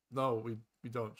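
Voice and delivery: apologetic waitress. Retold or imitated. Waitress turns down shrimp order, low and hesitant.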